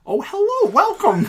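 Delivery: high, loud chirpy greeting. Shown as a demonstration. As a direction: squeal it out like an over-polite greeter.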